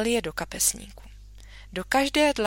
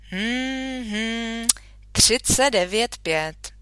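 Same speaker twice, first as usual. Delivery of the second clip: put-on brisk, loud, teasing doctor checking a feverish girl; jovial country doctor; 5 dB louder than usual. Doctor examining the sick girl, hearty and teasing. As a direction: boom it out like a cheery old doctor.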